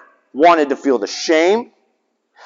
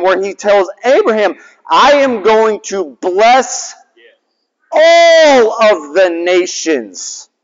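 higher, rising preacher's cadence; sermon voice, slowing on key scripture words; preaching; 4 semitones higher than usual. Acted. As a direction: preach the verses to the congregation.

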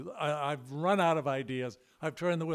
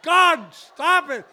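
much higher, louder panicked yell; screaming; 11 semitones higher, 8 dB louder, slower than usual. Retold or imitated. Frightened passenger Al screaming at the pilot to stop, high loud yell. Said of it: "Al is just screaming".

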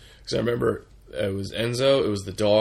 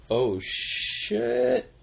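sudden high yelp; surprised; 13 semitones higher than usual. Real, not acted. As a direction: caught off guard, squeak it out.

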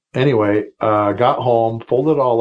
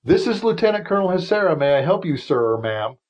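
high, brisk, formal officer's greeting; military phone answer; 7 semitones higher than usual. Retold or imitated. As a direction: rattle it off like on duty. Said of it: "in typical military fashion".

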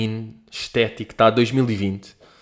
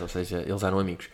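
normal talking voice

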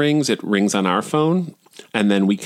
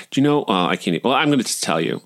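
ordinary delivery

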